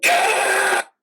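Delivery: very high, loud scream; anguished scream. Acted. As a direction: scream in sudden outrage. Explodes on hearing the sum.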